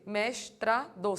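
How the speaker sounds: slow, each syllable of "Mestrado" split apart: mes-tra-do; teacher drilling "Mestrado" syllable by syllable. Shown as a demonstration. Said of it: "separa a sílaba".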